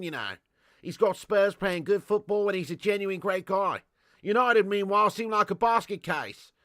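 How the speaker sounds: put-on Australian accent. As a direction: thank them like a matey Aussie. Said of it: "Gr-, great accent".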